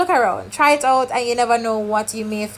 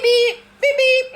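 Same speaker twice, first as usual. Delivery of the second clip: high electronic beeping; high-pitched beep beep beep; imitated scanner beep; 13 semitones higher, slower than usual. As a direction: mimic the machine beep, pitched way up. Card scanner keeps beeping.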